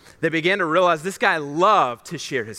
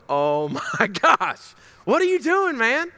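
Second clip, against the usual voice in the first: exasperated disbelief, jumping high in pitch; mock dismay; 6 semitones higher than usual. Young pastor silently questioning his leader, mock dismay. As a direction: play an inner freak-out for laughs.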